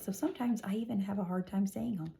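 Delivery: soft unvoiced confession; whispered aside. Real, not acted. Teacher admitting to pupils the words are hard. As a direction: whisper it like a little secret.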